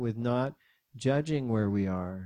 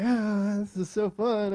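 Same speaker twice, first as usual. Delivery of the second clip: put-on high, louder toddler delight; gleeful little kid; 10 semitones higher, 5 dB louder than usual. Son shaking dad's head, imitated childish glee. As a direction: imitate a child having a great time.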